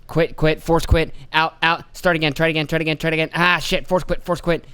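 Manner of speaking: lightly voiced panicking boss at the computer; frantic boss. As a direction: rattle off commands like a flustered boss. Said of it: "because he's like".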